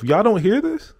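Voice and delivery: high-pitched disbelief, retold; incredulous thought. Vlogger to oblivious passers-by in his head, mock incredulous. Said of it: "I'm thinking to myself".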